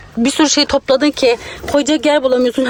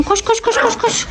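high, hurried urging; urgent; 10 semitones higher, 4 dB quieter than usual. Pretend. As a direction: rush them along, pitch up. Urging the girls to run.

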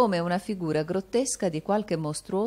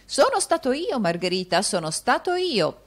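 put-on higher, raised old man's voice; spelling it out loudly; 6 semitones higher, 4 dB louder than usual. A narrator's reading. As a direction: make a deaf servant understand you. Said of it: "per render più intelligibile la risposta".

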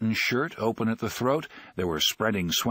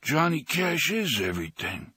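solemn, mock-grave pronouncement; half-serious grave declaration; 6 semitones higher than usual. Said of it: "Henry replied gravely".

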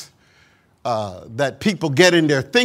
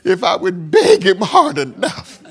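high, laughing, hammed-up bragging; exaggerated brag about tearful begging, laughing; mocking boaster; 7 semitones higher, 5 dB louder than usual. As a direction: ham up a smug believer. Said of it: "Oh, you see that".

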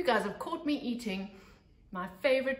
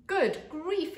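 higher, louder gasp of alarm; startled exclamation; 8 semitones higher, 6 dB louder than usual. Acted. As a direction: blurt it out, shocked by the headline. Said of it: "exclaimed Munch out loud".

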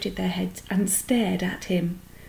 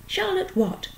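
high-pitched, eager asking; eager question; 12 semitones higher than usual. A narrator's reading. Wilbur asking the spider's full name, eagerly. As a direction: ask it eagerly, voice jumping up high. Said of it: "asked Wilbur eagerly".

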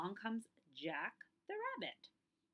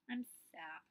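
sad whisper; 4 semitones lower than usual. Acted. Jack admits sadness after anger.

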